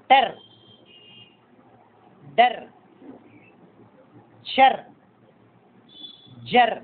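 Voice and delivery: one short syllable at a time, ending on R; pronunciation demo. Shown as a demonstration. Teacher showing how the hooked strokes are pronounced, careful pronunciation demo. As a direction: say పెర్, బెర్, టెర్ as separate syllables, vowel before the R. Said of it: "pronunciation ఎలా పలకాలంటే".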